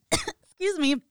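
coughing laugh, choking on breath. Real, not acted. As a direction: splutter through a laugh while choking. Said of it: "as I'm choking 'cause I'm, like, inhaling".

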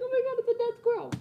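high, fast panicked squeal; retold panic, high and rushed; freaking out. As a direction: panic at the squirrel again. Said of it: "I'm freaking out".

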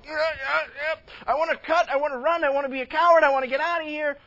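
loud, high mock panic; hammed-up panicky coward. Preacher voicing the inner self wanting to run, mock panicked. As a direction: yelp it out like someone desperate to bolt.

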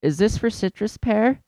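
high mock mom voice; squeaky clueless shopper; mimicking the mom asking; dumb question voice. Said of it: "she's like".

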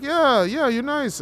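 loud falsetto imitation of an impressed instructor; mock-amazed, voice way up; high-pitched praise. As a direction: gush in a squeaky put-on high voice. Instructor keeps praising him.

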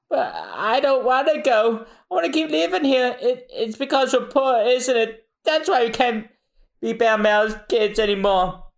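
put-on high upset little girl voice; crying child. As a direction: plead like a tearful young girl. Little girl refuses to leave.

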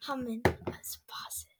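soft whisper; whispered aside. Real, not acted. Whispering to friend about the camera spying, hushed whisper.

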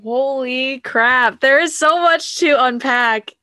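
loud, high, drawn-out astonishment; amazed. Real, not acted. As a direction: stretch it out, really blown away. Amazed reaction to guest's story.

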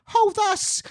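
high loud piggy squeal; squealing pigs. Acted. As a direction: squeal it like a needy little pig. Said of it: "You're doing a lot of voices".

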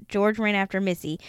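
plain reading voice

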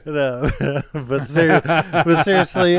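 trying to get serious through laughter; laughing. Real, not acted. Laughing while steering podcast back on topic.

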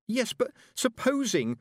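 put-on high, slow, woeful girl; plaintive, hesitant protest; struggling through woe. A narrator's reading. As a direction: struggle to get the misery out. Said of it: "struggling to get all the woe out".

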